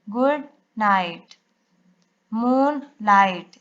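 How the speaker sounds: गुड नाइट and मूनलाइट said slowly, each part apart; split into syllables. Shown as a demonstration. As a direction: pause between the parts of गुड नाइट and मूनलाइट. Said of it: "यहाँ से divide करेंगे". (गुड नाइट और मूनलाइट को धीरे, टुकड़ों में)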